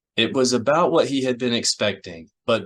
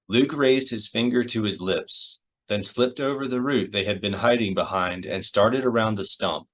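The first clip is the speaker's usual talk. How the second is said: ordinary delivery, nothing marked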